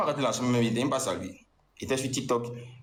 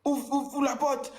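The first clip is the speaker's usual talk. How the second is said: high-pitched imitated yelling through the door; shrill retold demand to open up; mock yell; 13 semitones higher, slower than usual. Ex-girlfriend yelling at him through the hotel door, imitated high. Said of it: "tu as commencé à crier".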